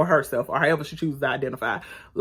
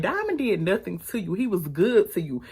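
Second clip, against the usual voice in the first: ordinary talk, nothing marked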